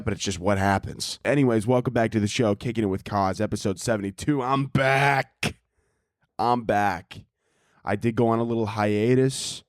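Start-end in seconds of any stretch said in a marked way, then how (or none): 4.4-5.5 higher, drawn-out comeback announcement; triumphant return. Real, not acted. Host back from a hiatus, triumphant. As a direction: announce your comeback with a big stretched BACK.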